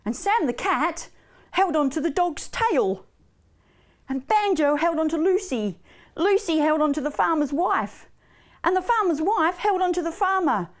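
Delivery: lively, measured singsong narration; rhythmic, clearly phrased story voice; children's storytelling. A narrator's reading. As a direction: read a cumulative tale to little ones.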